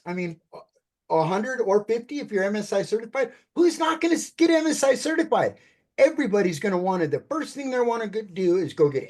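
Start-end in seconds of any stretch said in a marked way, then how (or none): 3.5-5.6 high, eager rhetorical question; excited. Real, not acted. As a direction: pitch it up, bursting with enthusiasm. Pitch rhetorical question, high energy.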